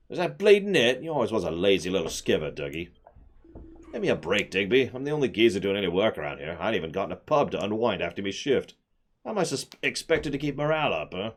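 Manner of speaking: cheeky Cockney miner complaining; Cockney accent. Acted. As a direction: grumble like a London dockhand. Miner argues with foreman.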